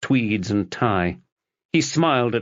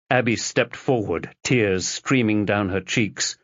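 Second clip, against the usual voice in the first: plain reading voice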